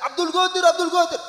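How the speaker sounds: high, loud mock screaming of a name; acted high-pitched yell; neighbour's shrieking. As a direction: holler it like a panicking neighbour. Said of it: "jerat-jerit".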